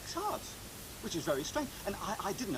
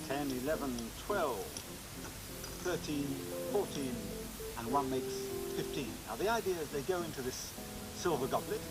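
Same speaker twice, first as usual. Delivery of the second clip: measured showman narration over music; magician's stage patter. Acted. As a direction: present each trick with a flourish. Magic show presenter patter.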